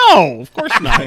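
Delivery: loud, high, laughing protest; laughing denial. Real, not acted. Host asked if he retweeted it, real laughing denial.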